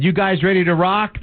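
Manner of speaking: high-pitched rock frontman call-out; stage yell. Acted. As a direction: call out to a concert crowd, no real shout. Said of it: "he yells out".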